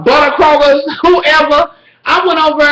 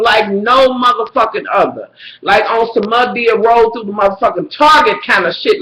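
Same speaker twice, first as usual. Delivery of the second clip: normal talking voice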